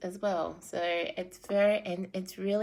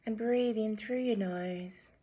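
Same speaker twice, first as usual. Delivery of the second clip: calm meditation guide; guided meditation; 5 dB quieter than usual. Acted. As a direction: guide them gently into breathing.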